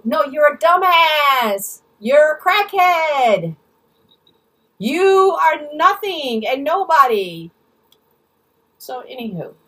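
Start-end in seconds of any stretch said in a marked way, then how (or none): 0.0-7.5 slow, loud, raised-pitch insults; drawn-out, emphatic contempt; angry name-calling. Real, not acted. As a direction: drive each insult home, drawn out and loud. Insulting the absent woman.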